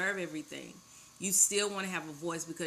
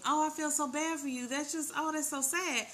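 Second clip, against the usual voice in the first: lightly mock pitying, higher; playful fake sympathy, raised pitch; sympathetic voice; 7 semitones higher than usual. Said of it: "instead of being sympathetic".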